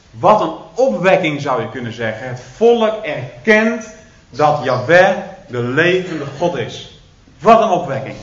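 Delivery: usual speaking voice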